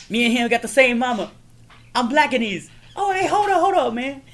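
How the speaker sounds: high, loud comic imitation; hammed-up movie quote. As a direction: play the movie lines high-pitched and lively. Re-enacting Rush Hour dialogue.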